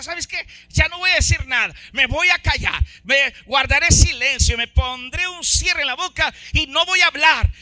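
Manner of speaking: fed-up outburst. Retold or imitated. Preacher replaying what he tells his wife, loud fed-up declaration. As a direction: act out throwing up your hands. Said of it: "Le digo a mi esposa".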